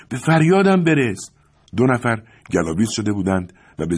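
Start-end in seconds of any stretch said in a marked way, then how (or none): 0.1-1.3 high, loud desperate plea; cry for help. A narrator's reading. A struggling man in the yard begs for rescue, shouting. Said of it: "صدای خفه دوباره منعکس شد".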